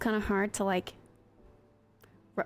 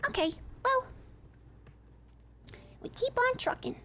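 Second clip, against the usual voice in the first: normal talking voice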